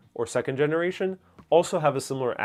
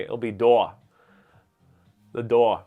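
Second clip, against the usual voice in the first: "door" in a thick Brooklyn way, final r dropped; very Brooklyn accent; 4 dB louder than usual. Shown as a demonstration. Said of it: "say in a very Brooklyn way".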